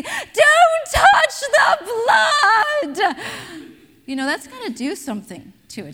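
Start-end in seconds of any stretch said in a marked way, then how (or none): 0.0-3.2 very high, long-drawn shriek; panicked teacher's shout. Retold or imitated. Teacher herding children from a bloody nose, hysterical shouting. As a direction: scream each word out slowly in alarm.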